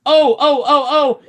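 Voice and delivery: high, loud squealing cries; crying trucks. A narrator's reading. Trucks bumping into each other, high loud cries. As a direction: shriek like bumping toy trucks. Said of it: "they cried again".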